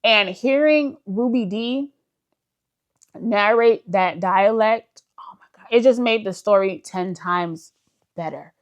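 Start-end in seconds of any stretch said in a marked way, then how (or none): 5.2-5.7 voiceless, breathy "oh my God"; breathless awe. Real, not acted. Vlogger overwhelmed by the narrated dialect, breathless awe. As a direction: let it out on pure breath, in awe. Raving about Ruby Dee's narration.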